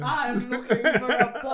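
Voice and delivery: mock teacher line through laughter; laughing. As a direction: quote it cracking up. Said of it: "and he went".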